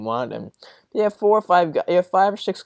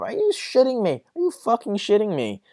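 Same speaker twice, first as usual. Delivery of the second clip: higher, incredulous outburst; real disbelief at a ridiculous claim; are you kidding me, thrown up high; outraged disbelief; 7 semitones higher than usual. Real, not acted. Outraged at a draft prediction.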